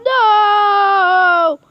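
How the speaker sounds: loud anguished cry; desperate no. Real, not acted. Begging his friend not to eat it, loud cry. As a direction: cry out to stop him. Protesting eating the apple.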